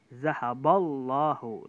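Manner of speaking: zahaballahu with a thick, heavy lam, slow and loud; heavy tafkhim lam. Shown as a demonstration. Teacher demonstrating the heavy lam to students, slow and loud. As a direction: boom out zahaballahu with a dark, full lam. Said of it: "Itu tebal".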